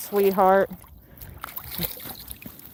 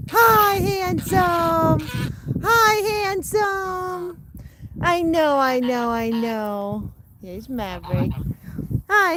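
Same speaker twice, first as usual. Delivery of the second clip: slow high cooing; 8 semitones higher, much slower than usual. Real, not acted. Cooing at geese.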